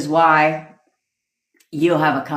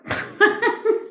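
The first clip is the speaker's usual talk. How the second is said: high breathy laugh. Real, not acted. Laugh after quote.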